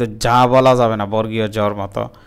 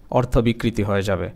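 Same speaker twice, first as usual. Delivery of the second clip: ordinary talk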